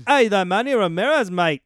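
loud, high mock Australian; Australian accent. Co-host riffing on Ramirez joining an Australian team, mock Australian. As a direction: belt it out as a hammed-up Australian. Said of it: "you know Australian".

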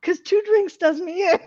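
giggling through the words; fighting back laughter, voice wobbling up; stifled giggles. Real, not acted. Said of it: "trying not to laugh".

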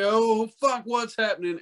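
high, alarmed cry; mock panic. Warning young guys about thirty, a high panicked cry. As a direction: yelp it like your body just gave out. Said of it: "you're like".

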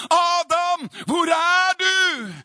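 high, full-force shout with a long drawn-out last word; God crying out. Acted. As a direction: call out as loud as you can, stretching the end. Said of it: "da må du rope bare".

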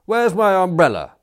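high, loud outraged question; indignant demand. A narrator's reading. Indignant youth confronts Smith, demanding. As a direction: demand it back at full voice. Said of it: "demanded the pink one".

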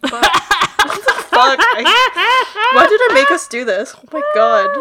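high, loud laughing through tears; overwhelmed shout between laughter and tears; real teary laughing outburst; crying laugh. Real, not acted. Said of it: "I'm tearing up".

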